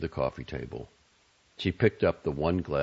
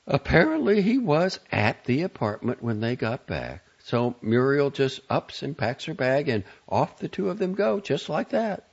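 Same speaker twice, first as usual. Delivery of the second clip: high, emphatic gossiping woman; exasperated matron of honor; 9 semitones higher, 3 dB louder than usual. A narrator's reading. Matron of honor recounting the elopement, exasperated. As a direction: recount it with indignant emphasis, pitched up.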